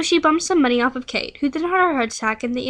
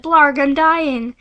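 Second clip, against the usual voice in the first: very slow, louder mock death groan; mock dying groan; 4 dB louder, much slower than usual. [name] in the pool, hammed-up slow dying groan. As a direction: drag it out like dying.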